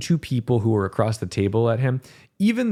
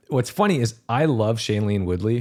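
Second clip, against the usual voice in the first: normal talking voice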